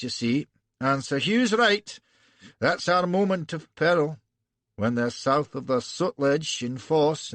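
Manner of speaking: lecturing arrogant Scot; Scottish burr. Acted. Broadfoot lecturing the prince on strategy, Scottish burr. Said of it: "arrogant Scotch burr".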